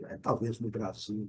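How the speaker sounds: normal talking voice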